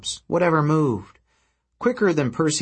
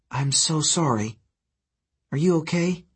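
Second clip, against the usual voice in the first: gentle concern; 4 semitones higher than usual. A narrator's reading. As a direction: check gently on someone hurt. Comforting the hurt, cowering harpy.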